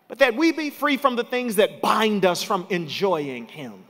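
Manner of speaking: rising to a shouted climax; preacher lifting the church about Jesus, building to a shout; preaching at full force. Acted. Bring it home, loud and high.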